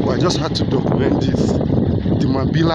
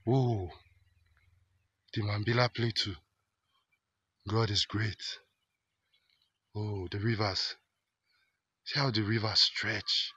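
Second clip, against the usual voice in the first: a real hushed wonder at the plateau; hushed awe; 4 semitones lower, 11 dB quieter than usual. Real, not acted. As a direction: murmur it as if in a holy place.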